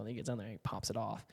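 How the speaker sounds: normal talking voice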